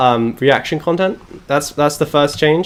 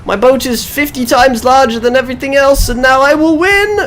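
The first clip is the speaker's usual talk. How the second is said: much higher, loud, gloating brag; boastful rival captain; 10 semitones higher, 5 dB louder than usual. Retold or imitated. Mocking a rival player with a massive boat, high and gloating. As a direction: crow like a smug captain showing off a huge boat. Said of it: "they're like".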